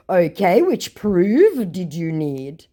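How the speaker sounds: high, loud put-on voice, slowing right down; mock-obliging, drawn-out question; idiot scammer. Scammer offers her proof, slow mock-obliging voice. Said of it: "To which my idiot says".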